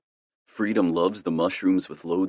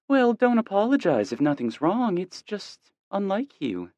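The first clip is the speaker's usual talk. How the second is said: higher, gentle mom voice; a mom lightly scolding her son; mother voice. A narrator's reading.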